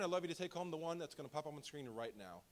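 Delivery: usual speaking voice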